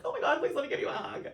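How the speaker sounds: high, loud, rushed tearful plea; mock-tearful high squeal; emotional gushing. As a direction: blurt it out as if overcome and crying. Retold emotional plea to hug.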